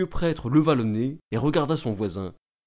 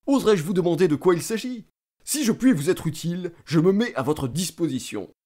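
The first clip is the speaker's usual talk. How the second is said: eager official, pitched up; high, pressing politeness; officious state councillor; 5 semitones higher than usual. A narrator's reading. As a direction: offer your services with self-important eagerness. Voices the councillor offering his services.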